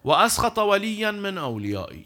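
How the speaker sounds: loud, slow Arabic recitation; solemn recitation. Acted. Preacher reciting the Arabic hadith to the congregation, loud and slow. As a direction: intone the hadith slowly.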